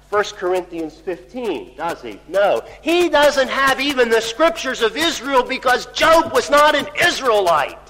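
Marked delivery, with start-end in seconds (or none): 2.3-7.8 loud, raised-pitch insistence; emphatic preaching. Real, not acted. Preacher telling the congregation Job had no Scriptures, loudly emphatic. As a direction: drive the point home at full force. Preacher drives home his point.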